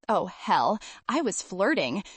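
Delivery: usual speaking voice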